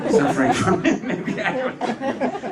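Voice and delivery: high, loud laughter through the words; laughing. Real, not acted. Speaker laughing about Danes watching other Danes suffer, laughing. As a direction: laugh while saying it.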